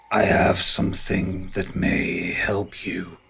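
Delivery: low, slow ent voice; drawn-out, measured ent; ancient tree creature. Acted. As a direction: offer it ponderously, like an old tree.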